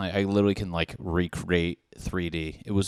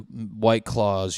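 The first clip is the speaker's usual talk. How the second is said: ordinary delivery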